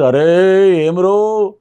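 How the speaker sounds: film dialogue delivery. Retold or imitated. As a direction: stretch each word like a screen villain. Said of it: "అలా dialogue Sripathiraju". (నెమ్మదిగా సాగదీసిన cinema dialogue శైలి)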